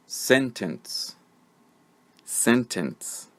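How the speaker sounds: "Sentence" said alone as SEN-tuhns; careful word model. Shown as a demonstration. Teacher saying the word so learners find the schwa, careful model. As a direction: dictate "Sentence" with a reduced ending, then repeat. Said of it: "I'm going to say the word".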